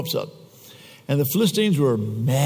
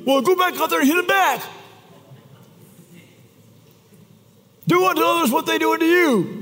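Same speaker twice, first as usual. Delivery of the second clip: high, exaggerated parent voice; hammed-up mom telling the kid to hit back; a satirical high parental voice; mock parent advice; 12 semitones higher than usual.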